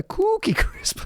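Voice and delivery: high cartoon mascot cry; hammed-up mock sponsor plug; cereal commercial imitation. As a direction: sing out the brand like an old cereal ad. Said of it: "if anyone remembers those commercials".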